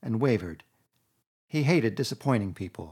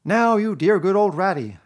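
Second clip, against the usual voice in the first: louder, higher, coaxing plea; imploringly; 8 semitones higher, 9 dB louder than usual. A narrator's reading. Toad imploring a reluctant Rat, coaxing. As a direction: wheedle him. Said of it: "said Toad imploringly".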